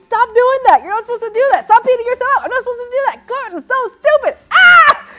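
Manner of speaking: loud, high frantic self-scolding; hysterical, hammed-up inner critic; beating herself up. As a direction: yell at yourself, spiralling into a scream. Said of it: "I'd beat myself up".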